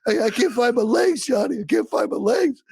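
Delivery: high, wailing mock sob of a wounded soldier; hammed-up crying soldier. A dying soldier begs his friend Johnny, mock sobbing. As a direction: overplay the crying, pitch way up. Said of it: "he's like".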